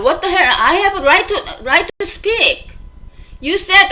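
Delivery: loud, high-pitched indignant outburst; acted-out near yell. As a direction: protest loudly, as if about to yell. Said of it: "I was almost like a yell".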